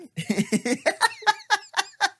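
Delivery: high, loud burst of laughter; cracking up at his own prank; big laugh. Real, not acted. Telling the prank story, he bursts into loud high laughter.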